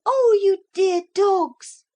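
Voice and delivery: high, loud, drawn-out cry of joy; girl overjoyed to see her dogs; delighted cry. Acted. Lucy greets her dogs, high and loud with joy. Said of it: "Lucy cried".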